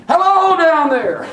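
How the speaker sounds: slow, loud, high call of Hello down there; holler down the drain. Shown as a demonstration. Teacher showing students how to holler down a sink drain, loud slow call. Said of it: "look down that drain and holler".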